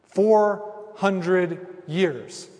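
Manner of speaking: each word drawn out heavy; very slow, weighty repetition; slow emphasis. Real, not acted. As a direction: let four hundred years sink in. Preacher repeats for weight.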